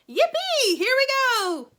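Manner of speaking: long high whoop; joyful shout. A narrator's reading. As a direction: whoop with glee. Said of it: "shouted Grandpa Joe again".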